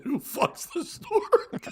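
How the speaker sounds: high, drawn-out puzzlement; puzzled question. A narrator's reading. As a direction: ask it slowly, pitch climbing.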